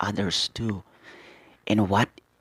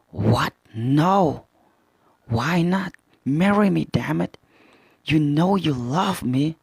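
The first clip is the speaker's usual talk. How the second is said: mock outburst; 7 semitones higher, 4 dB louder, slower than usual. Ginny rejects, Kuma pushes proposal.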